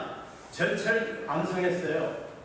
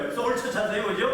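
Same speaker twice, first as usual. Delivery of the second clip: normal talking voice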